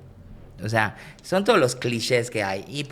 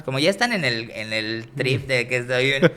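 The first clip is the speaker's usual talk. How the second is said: ordinary talk, nothing marked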